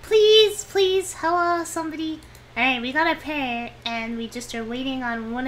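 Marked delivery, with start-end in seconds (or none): 0.0-2.2 high, loud begging, easing off toward the end; whining plea. Acted. Player begging the table for a tile, mock whining. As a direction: whine for someone to respond. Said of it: "it sound like I'm whining".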